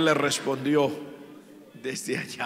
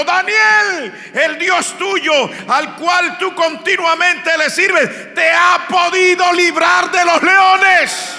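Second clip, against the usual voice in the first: slow, loud, much higher desperate call; king shouting; 12 semitones higher, 9 dB louder, slower than usual. Acted. King calling Daniel at the pit, desperate shout. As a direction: cry out to Daniel in the lions' den. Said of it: "Está gritando".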